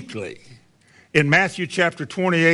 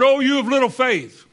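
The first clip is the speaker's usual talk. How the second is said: Jesus rebuking; 7 semitones higher than usual. Acted. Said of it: "He said".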